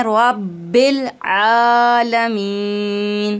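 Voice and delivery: रब्बिल आलमीन slow with the laam kept steady; correct recitation. Shown as a demonstration. Teacher reciting रब्बिल आलमीन correctly. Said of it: "लाम की आवाज़ हमें नहीं पलटना है".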